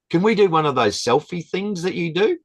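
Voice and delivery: high, excited imitation of the lady; the lady asks the volunteer for a selfie, high and eager; eager request. Imitating an eager old lady.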